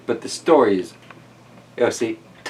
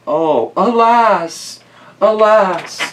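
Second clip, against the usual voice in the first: mock lament; 9 semitones higher, 7 dB louder, much slower than usual. Mock dramatic lament at picture.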